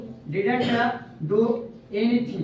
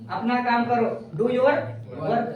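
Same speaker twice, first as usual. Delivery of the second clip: slow dictation; much slower than usual. Shown as a demonstration. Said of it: "dictating slowly".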